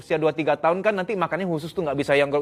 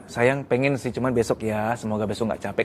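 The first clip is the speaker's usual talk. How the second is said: gentle refusal; 5 semitones lower than usual. Shown as a demonstration. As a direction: soothe him while saying no. (istri menolak dengan lembut, suara pelan)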